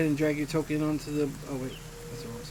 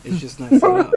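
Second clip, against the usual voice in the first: high defensive whine; 17 dB louder than usual. Acted. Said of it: "defensive high protest".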